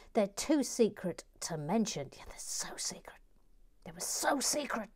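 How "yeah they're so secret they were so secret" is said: hushed mock-confidential whisper; playful conspiratorial whisper; secretive whisper. Keeping builds secret in whisper.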